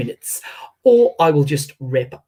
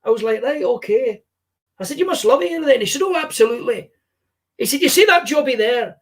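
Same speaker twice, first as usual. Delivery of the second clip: Billy Connolly impression; 9 semitones higher than usual. S0 voices Billy Connolly telling joke.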